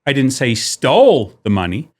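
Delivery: "stole" leaned on, rising; stress on stole. Shown as a demonstration. Showing emphasis changes meaning, stressing stole. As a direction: hit the word "stole". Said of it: "wherever you put the emphasis".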